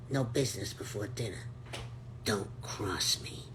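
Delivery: low, quiet menacing villain voice; lightly voiced growling villain; Crim voice. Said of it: "fun voicing Crim".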